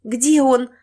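high, loud desperate question; frantic, urgent cry; panicked demand. Acted. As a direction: demand your child in fear. Panicked mother demands her missing baby.

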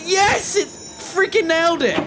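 high excited yell of victory; triumphant shout. Real, not acted. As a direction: shout it, proving your point. Tube door hits the patch.

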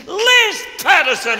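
high, slow hearty call of a name; booming mock welcome; loud greeting. Mel greeting Les loudly.

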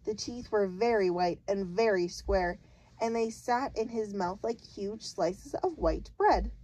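ordinary delivery

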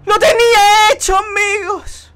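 loud, slow wailing lament; mock wail. Real, not acted. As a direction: cry out in dramatic despair. Just failed the jump.

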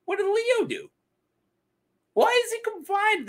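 high, puzzled pitch as if truly confused; baffled questions. Pretend. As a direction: ask it like you can't believe nobody explains.

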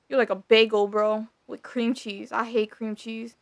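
usual speaking voice

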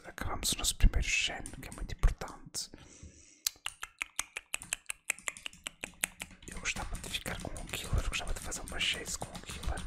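hushed, unvoiced gaming commentary; murmured play-by-play, all in whispers; ASMR whisper. Real, not acted. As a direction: whisper close to the mic for an ASMR video.

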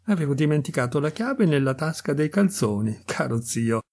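plain reading voice

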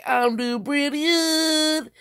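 mock high answer to a greeting; put-on reply. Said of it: "I'm gonna say".

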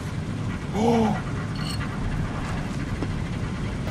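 sharp unvoiced gasp of fright; startled gasp. Real, not acted.

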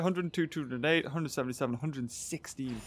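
ordinary delivery

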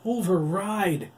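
loud, high, slow command; desperate shouted override. Acted. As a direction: bark the order. Oliver commands the machine.

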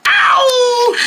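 sharp pained cry; high yelp of pain. Acted. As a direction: yelp as if struck. Character is hurt.

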